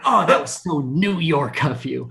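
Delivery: lightly hammed-up delighted gushing; excited wife. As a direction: imitate a wife thrilled by her husband's rudeness. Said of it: "my wife gets so excited".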